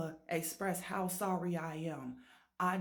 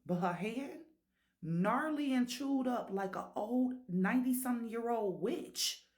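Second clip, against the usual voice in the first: normal talking voice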